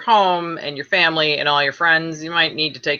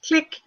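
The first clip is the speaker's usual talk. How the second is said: door click; 12 semitones higher than usual. Retold or imitated. Mimicking the door clicking shut.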